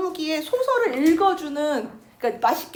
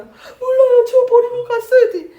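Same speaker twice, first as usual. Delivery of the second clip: very high, loud tearful answer; crying child; 9 semitones higher, 10 dB louder than usual. Retold or imitated. Little girl to the soldiers, very high and crying. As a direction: sob it out, terrified.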